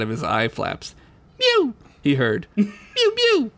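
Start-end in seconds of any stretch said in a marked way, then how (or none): 1.4-1.7 high squeaky kitten mew. Acted. Cat voice in story. 2.6-3.5 high squeaky kitten mew. Acted. Cat voice in story.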